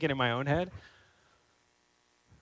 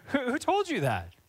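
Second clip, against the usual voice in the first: higher, incredulous Who told you that; pointed challenge; 9 semitones higher than usual. Real, not acted. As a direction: challenge them with disbelief. Pressing the listener.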